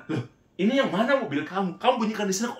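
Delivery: high-pitched, puzzled questioning; acted bewilderment. Asking a friend which car honked, baffled. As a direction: imitate yourself baffled, voice jumping up.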